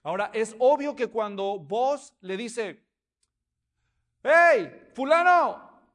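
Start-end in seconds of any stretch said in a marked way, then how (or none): 4.2-5.8 loud high call across the square; shout. Acted. Boaz shouting to the man in the crowded square, loud call. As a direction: yell his name so everyone hears. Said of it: "oyeron el grito".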